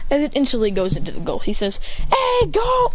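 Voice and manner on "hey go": high excited cheer. Acted. Boy celebrates his goal, high excited cheer. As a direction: cheer it up high like you scored.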